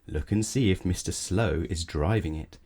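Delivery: plain reading voice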